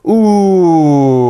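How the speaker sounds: long loud "ooh" sliding down from high; vocal exercise ooh. Shown as a demonstration. Coach demonstrates a vocal exercise to students, long falling ooh. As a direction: push the ooh steadily downward. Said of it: "demonstrate what that sounds like".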